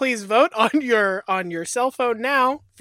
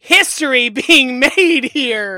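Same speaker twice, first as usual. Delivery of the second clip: mock announcer; 4 semitones higher, 10 dB louder, slower than usual. Mock announcer, slow and loud.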